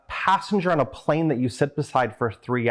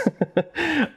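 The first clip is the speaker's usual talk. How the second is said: high laugh. Real, not acted. Self-deprecating remark.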